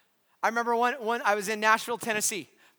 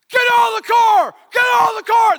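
much higher and louder, barked orders; loud retold police yell; cop shouting; 14 semitones higher, 14 dB louder than usual. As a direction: yell it like a cop with a gun drawn. Cop yells at him.

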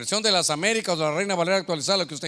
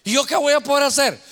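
panicked; 6 semitones higher, 4 dB louder than usual. Retold or imitated. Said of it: "Uno cuelga y".

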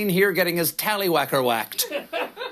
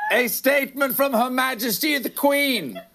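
town crier; 7 semitones higher than usual. Acted. Palace statement announced by a crier.

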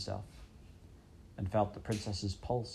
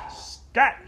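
loud, high shouted "scat"; a furious royal shout; 9 dB louder than usual. Acted. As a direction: bark the mouse away. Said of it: "he shouted".

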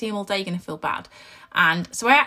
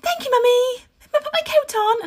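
put-on high, sing-out little-child voice; happy toddler; 4 dB louder than usual. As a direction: mimic a cheerful child, pitched right up. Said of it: "like, all happy".

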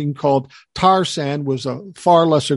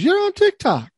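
high, amazed "You're on TikTok?"; surprised. Retold or imitated. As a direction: imitate her disbelief, pitched way up. Woman surprised he's on TikTok.

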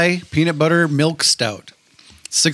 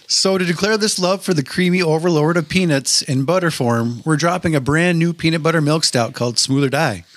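normal talking voice

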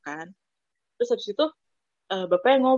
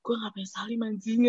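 soft, under-the-breath replay of regret; regretful self-talk; 8 dB quieter than usual. Retold or imitated. Student replaying the mistake on the way to class, soft regret.

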